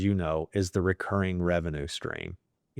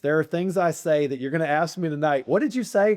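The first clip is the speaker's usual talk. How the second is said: excited; 9 semitones higher, 4 dB louder than usual. Real, not acted. Playful boast about being spontaneous.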